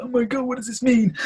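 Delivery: high, loud, frightened cry of confusion; panicked young self. Retold or imitated. Tearful boy to his parents, a high panicked cry. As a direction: squeal it out like a scared kid. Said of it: "still, like, tearing up".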